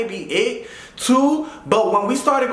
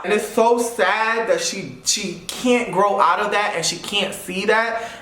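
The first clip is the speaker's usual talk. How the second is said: ordinary talk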